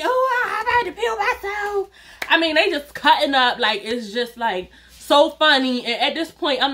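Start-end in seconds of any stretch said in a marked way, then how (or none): 0.0-1.9 much higher, laughing through the words; laughing cousins. Retold or imitated. Cousins on the ledge mocking her, laughing hard. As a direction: wheeze it out like you can't stop laughing. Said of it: "They laughing".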